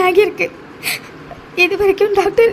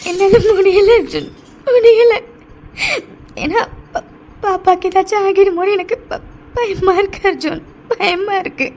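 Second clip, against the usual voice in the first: crying in fear. Acted. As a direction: break down, then trail into tearful fear. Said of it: "அழாதடி". (தாங்க முடியாத அழுகை)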